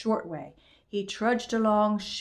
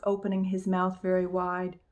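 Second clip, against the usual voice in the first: ordinary delivery, nothing marked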